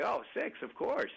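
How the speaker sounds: lightly hammed-up yes-man; mock eager compliance. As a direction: chirp it back like someone angling for the cookie. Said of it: "you're gonna say".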